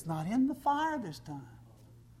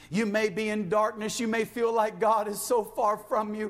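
raised, driving preacher's voice growing higher; building exhortation; 5 semitones higher, 3 dB louder than usual. Real, not acted. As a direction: urge the congregation with mounting fervor. Builds exhortation at full force.

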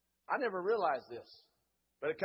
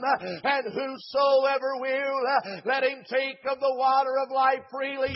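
raised preaching voice building to a shout; fervent altar call; 9 semitones higher, 17 dB louder than usual. Real, not acted. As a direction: urge them to come, driving each phrase out loud. Altar call preached at full force.

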